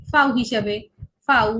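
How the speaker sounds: ফাউ said as fow, an f sound with an ow vowel; German letter name. Shown as a demonstration. Teacher explains German V to Bengali students as ফাউ. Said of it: "ভি কে উচ্চারণ করা হবে".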